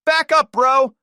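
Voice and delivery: very high, very loud yell. A narrator's reading. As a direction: shout him back. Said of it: "Danny yelled".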